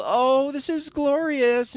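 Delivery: high, slow gushing; mock gushing. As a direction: sneer it like a gushing fan. Mocking pundits' gushing reaction.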